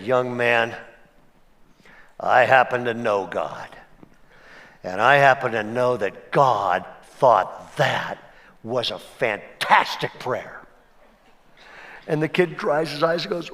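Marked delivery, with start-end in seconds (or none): 0.0-10.6 lightly voiced grandfatherly man; kindly old man. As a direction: lean in and reassure the boy gently. Older man addresses the boy.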